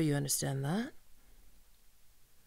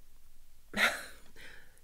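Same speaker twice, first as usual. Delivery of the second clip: soft sinister chuckle; 9 dB quieter than usual. Acted. Villain amused.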